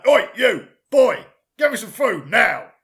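loud, high, punchy demand; mock his co-host as a barking diner; rude customer impression. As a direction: order the waiter about loudly. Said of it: "me doing an impression of you".

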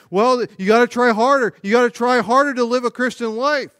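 mock a pastor urging the flock; hammed-up pulpit push; preachy pastor exhortation. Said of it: "what do pastors say".

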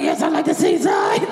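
high, forced shout through pain; high pained shouted reply; strained answer. Retold or imitated. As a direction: yell the reply through gritted teeth. Answering while in agony.

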